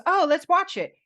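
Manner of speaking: high, loud, eager retold reply; acted-out burst of enthusiasm; eager suggestion. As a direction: jump in keenly, imitating his enthusiasm. Retelling his eager movie suggestion.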